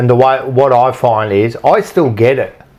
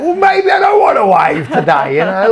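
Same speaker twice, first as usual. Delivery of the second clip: defiant mock shout; 7 semitones higher than usual. Defiant comeback to friend's teasing.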